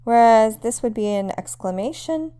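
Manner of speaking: ordinary talk, nothing marked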